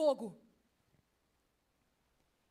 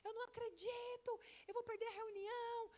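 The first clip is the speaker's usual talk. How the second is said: much higher, panicked mock shout; stuck in São Paulo traffic, shrieking at the rain; frantic car yell; 11 semitones higher, slower than usual. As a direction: rant at the traffic, stuck and late. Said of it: "Eu comecei a gritar dentro do carro, nervosa".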